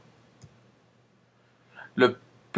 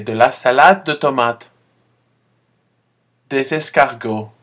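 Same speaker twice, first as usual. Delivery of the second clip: clear, louder teacher model; model repetition; 6 dB louder than usual. Shown as a demonstration. Teacher modelling a word for the class, loud and clear. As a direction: say it clearly for students to repeat. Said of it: "Répétez, s'il vous plaît".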